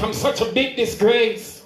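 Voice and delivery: ordinary talk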